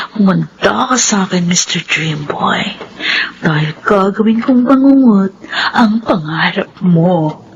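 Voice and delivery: slow, low villain's promise to herself; low scheming menace. Acted. Liza alone plots against her mother's husband, low scheming menace. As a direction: savor the revenge plan, slow and low.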